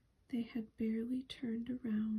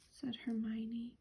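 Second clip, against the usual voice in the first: plain reading voice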